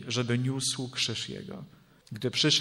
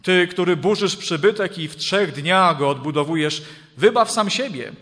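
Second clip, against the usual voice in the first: loud, raised voice of jeering passers-by; reviling taunt; 5 semitones higher, 7 dB louder than usual. A narrator's reading. Passers-by cursing the man on the cross, loud taunt. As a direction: hurl it out as a loud, emphatic challenge. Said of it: "przeklinali go i potrząsali głowami".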